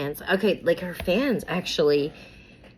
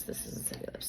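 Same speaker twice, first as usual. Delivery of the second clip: "This isn't City Lips." whispered, much softer; under her breath; 17 dB quieter than usual. Real, not acted. Vlogger finds the wrong lip gloss and whispers to herself. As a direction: mutter it to yourself in a whisper.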